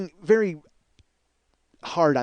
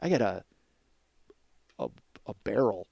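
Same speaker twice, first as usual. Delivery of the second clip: softer, lower, deflated; self-pitying mutter; 6 semitones lower, 9 dB quieter than usual. Retold or imitated. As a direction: mumble it like you've given up. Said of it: "You're just like".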